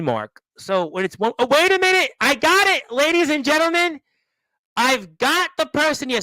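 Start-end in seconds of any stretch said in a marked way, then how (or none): 1.4-6.2 higher and louder, sudden burst of news; excited surprise. Real, not acted. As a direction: announce the guest like a ring announcer. Guest finally arrives.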